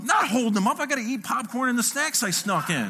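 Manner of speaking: acted high-pitched protest; indignant outburst. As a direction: fire back in comic exasperation. Acted outburst back at wife.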